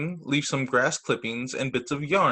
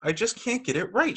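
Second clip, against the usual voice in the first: complaining; 6 semitones higher than usual. Acted. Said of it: "she complained".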